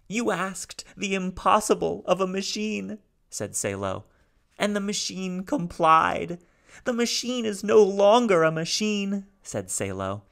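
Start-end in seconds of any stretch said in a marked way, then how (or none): 0.1-3.0 high, louder, halting alien voice; speaking haltingly. Acted. Salo's voice. 4.6-9.3 high, louder, halting alien voice; speaking haltingly. Acted. Salo's voice.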